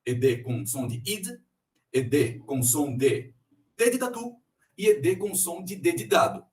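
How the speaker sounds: normal talking voice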